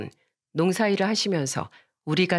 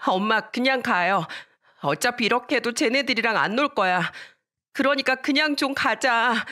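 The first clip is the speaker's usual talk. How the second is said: put-on higher-pitched child's fed-up whine; insistent tugging protest; 5 semitones higher than usual. Daughter pulls her pleading mother away, high fed-up insistence. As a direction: urge Mom away, voice climbing. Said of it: "엄마를 잡아당기면서 말했어요".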